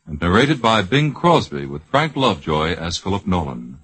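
ordinary delivery

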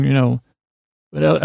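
ordinary talk, nothing marked

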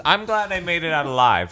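ordinary delivery

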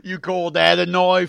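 badly faked Australian accent, drawn out; attempted Australian accent. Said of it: "was that Australian?".

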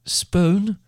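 high rising call, questioning; shouted call. A narrator's reading. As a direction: call out for her from another room. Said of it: "by her mother shouting from the other room".

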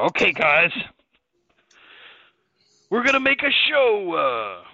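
lightly voiced The Rock style Triple H; hint of a booming wrestler promo; Triple H impression. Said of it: "I'm doing my best rock impression of Triple H".

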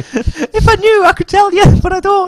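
very high, squeaky put-on voice. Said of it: "What is that?".

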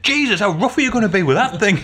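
joking to the nurse about her rough handling; mock alarm. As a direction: joke it out through a laugh. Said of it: "And I said".

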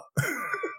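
high squeal laugh. Real, not acted. Laughing at own impression.

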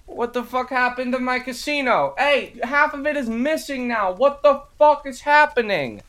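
high-pitched panicked yelling character voice; frantic high casino owner. Acted. Casino owner yelling at the party, high and panicked. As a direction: shriek in outrage at your wrecked casino.

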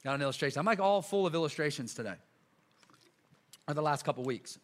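ordinary talk, nothing marked

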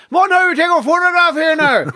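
high, loud cheerful shout; loud cheery call. Retold or imitated. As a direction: call out brightly to the group. Friends urging photo on summit.

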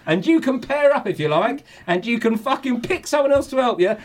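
rising mock fury; reading out the rant. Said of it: "this is what he said".

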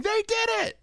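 high, triumphant mic call; triumphant announcer. Pretend. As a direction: celebrate into the microphone.

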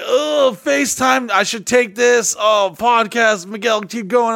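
high, excited, easily distracted; scattered ADD voice. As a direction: jump excitedly from thought to thought. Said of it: "my A D D was like".

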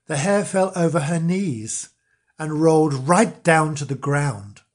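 ordinary delivery

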